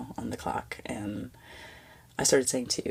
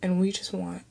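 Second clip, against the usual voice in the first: choked up; 5 semitones higher than usual. Real, not acted. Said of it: "I'm getting a little bit emotional".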